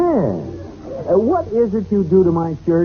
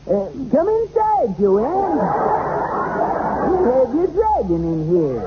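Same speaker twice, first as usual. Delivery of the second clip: high squeaky elf voice; christmas elf; 7 semitones higher than usual. Acted. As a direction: pitch way up like a tiny elf. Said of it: "Use an elf voice".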